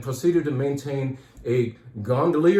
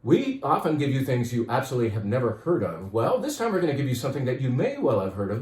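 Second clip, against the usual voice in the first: usual speaking voice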